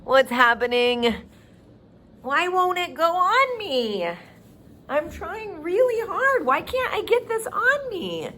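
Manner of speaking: high, hammed-up frustration at a tiny shirt; teacher clowning for kids, high and mock-frustrated; mock struggle. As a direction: pretend you can't get the shirt on, playfully. Teacher pretends shirt won't fit.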